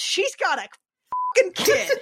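sudden high squeal of disbelief; shocked outburst. Acted. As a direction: blurt it out, shocked.

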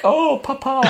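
high, hammed-up French-accented papa; mock French accent. Family in the film doting on papa, in a mock French accent. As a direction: ham up a French family member calling papa. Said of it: "They're all a bit like that".

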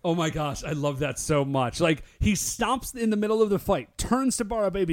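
normal talking voice

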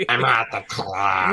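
slow, eerie clown voice; Art the Clown voice. Pretend. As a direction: drawl it like a creepy clown. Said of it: "what I reckon Art the Clown would sound like".

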